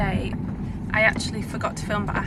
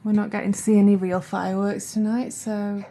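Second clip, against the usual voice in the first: ordinary delivery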